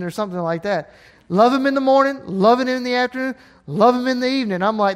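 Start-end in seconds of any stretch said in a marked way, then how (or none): 1.3-4.6 loud, high preaching climax; preaching at full force. Acted. Preacher urging the church to love Him all day, fervent. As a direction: drive it home to the church.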